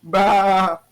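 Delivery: short booming war cry; loud battle shout. Pretend. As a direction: bark it out like a charging warrior. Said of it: "you just hear a loud".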